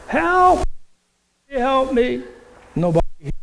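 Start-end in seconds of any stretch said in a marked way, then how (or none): 0.1-2.2 high, desperate call for help; a man clinging to a bush yelling for help; crying out for help. Retold or imitated. Man hanging from a bush calls out, desperate and high. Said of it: "he cries out for help".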